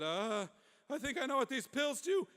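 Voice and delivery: very high, strained alarm; urgent distress. Acted. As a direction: squeak it out in comic urgency. Clark in sudden stomach distress.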